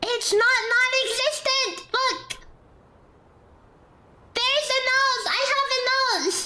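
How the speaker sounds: high, indignant yelling; outraged protest. Real, not acted. Indignant defending own nose.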